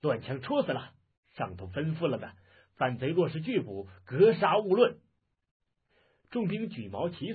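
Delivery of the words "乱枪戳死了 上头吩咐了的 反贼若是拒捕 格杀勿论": officer's angry order, building to a loud shout; enraged shouting. Acted. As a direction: order an attack in rising fury. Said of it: "怒不可遏".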